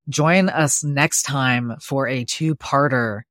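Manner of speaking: slow, loud show announcer. Acted. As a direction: announce it like a radio host. Podcast outro announcement.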